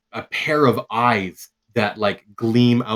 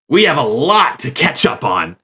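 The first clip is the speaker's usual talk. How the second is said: louder, strange hollow voice; ominous armored figure; 6 semitones higher, 9 dB louder than usual. Acted. Armored Ka figure greeting the group, loud and eerie. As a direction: speak like a faceless undead knight. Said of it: "And they go".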